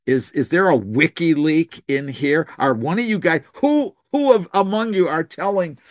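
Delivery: high, agitated demanding king; hollering king. Retold or imitated. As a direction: shout at the generals, suspicious and upset. He's hollering at his generals.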